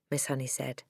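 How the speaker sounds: ordinary delivery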